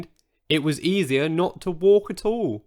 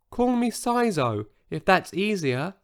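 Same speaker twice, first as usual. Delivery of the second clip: tiny voice; 6 semitones higher than usual. Acted. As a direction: shrink the voice down to a tiny one. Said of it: "in a voice so tiny".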